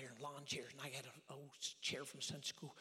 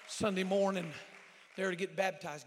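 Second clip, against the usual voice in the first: proclaiming loudly; 4 semitones higher, 15 dB louder, slower than usual. Real, not acted. Proclaiming his baptism date.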